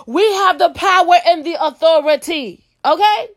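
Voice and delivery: high-pitched, emphatic preaching; rallying preacher. Real, not acted. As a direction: proclaim it high, rallying the sisters to battle. Rallies listeners like a preacher.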